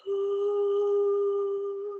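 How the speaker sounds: airy ooh, lots of breath through it; breathy sung ooh. Shown as a demonstration. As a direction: let a lot of air move through the tone. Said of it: "There's a lot of air moving through".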